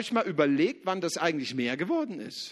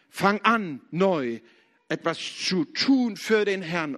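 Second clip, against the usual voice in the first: louder call, hitting "an" hard; louder repeat; 5 dB louder, slower than usual. Shown as a demonstration. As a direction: raise your voice on the repeat and press "an". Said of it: "Ich will dir aber heute eins sagen".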